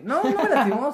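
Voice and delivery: loud, high-pitched mock protest; retold emphatic denial. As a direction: deny it loudly, as if acting out your own reply.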